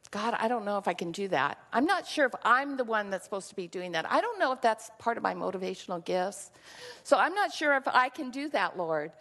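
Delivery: hurried doubtful prayer. Retold or imitated. Retold own doubting prayer to God.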